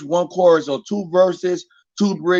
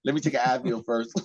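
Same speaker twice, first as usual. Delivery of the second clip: weary Jake; 7 semitones lower, 10 dB quieter than usual. Retold or imitated. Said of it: "Jake looking at me like".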